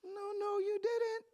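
put-on retelling his denial in a squeaky kid voice; high whiny kid denial. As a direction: whine it like a caught sixth grader. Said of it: "I was like".

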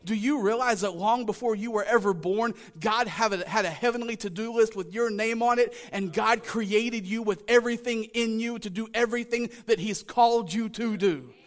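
full-force preaching. Real, not acted. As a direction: drive it home to the congregation.

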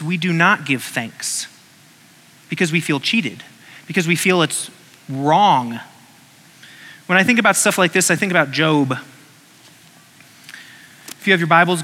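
ordinary talk, nothing marked

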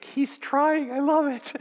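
very high put-on wife voice; a high mock wife, delighted; imitating the wife. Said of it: "your wife will think".

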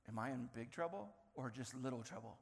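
low, soft voicing of a child's thought; quiet inner thought. Toddler wondering if he is in trouble, quiet inner thought.

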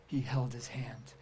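soft breathy whisper. Real, not acted. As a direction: hush it, tender and close. Tender aside about the leper.

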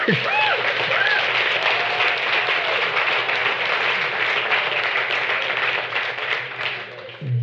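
long high laugh; high delighted laugh. Real, not acted. Laughing after the proclamation.

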